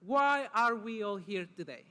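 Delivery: normal talking voice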